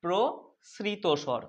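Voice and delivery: drawn-out teaching of প্রসৃত স্বর; careful slow classroom model; slow model word. Shown as a demonstration. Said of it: "প্রসারিত স্বর বা".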